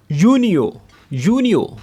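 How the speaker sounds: यूनियो with y instead of j; Arabic pronunciation. Shown as a demonstration. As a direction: stress यूनियो, y not j. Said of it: "जून को Arabic में बोलते हैं". (अरबी में यूनियो, जीम नहीं)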